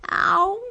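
very high, loud yelp of pain; yelled ow. A narrator's reading. Boy thudding helmet first into the wall, loud yelp. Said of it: "he yelled".

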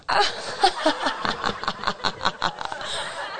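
long, high fit of giggles; laughing. Real, not acted. Host cracking up at a dad joke, long laughing.

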